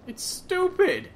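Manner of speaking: loud, high, slow exasperation; defensive outburst. Real, not acted. Player dismissing his friend's nonsense phrase, defensive and high-pitched. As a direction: stretch it out high and indignant. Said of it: "Why are you getting so defensive?".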